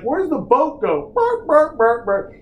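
high silly voice, burping noises; mock captain. Mocking the dumb boat captain, high and silly. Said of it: "I'm like".

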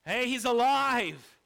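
acted excited cry, drawn out; excited announcement. Mary back from the tomb telling the disciples, excited. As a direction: call out the good news, stretching it.

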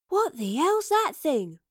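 slow, high-pitched amazement; awestruck, drawn-out question; awed. A narrator's reading. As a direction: stretch the question out in wonder. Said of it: "asked Astrid in awe".

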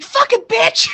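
loud high shout of pain; sudden screamed curse. Retold or imitated. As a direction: yell it as if it really hurts. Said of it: "I was like".

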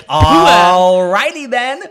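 very loud, high, drawn-out catchphrase; over-the-top comic showman; Ace Ventura. Acted. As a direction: stretch it out and blast it. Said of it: "but it is Ace Ventura".

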